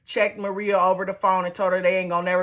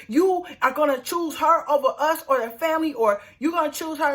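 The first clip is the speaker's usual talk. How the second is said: higher-pitched indignant challenge; mock confrontational demand, pitched up; arguing; 7 semitones higher than usual. [name] confronting Michael over choosing her, arguing.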